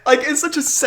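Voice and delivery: normal talking voice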